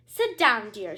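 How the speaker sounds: bossy queen voice; lightly voiced royal order; stern queen. A narrator's reading. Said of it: "she said".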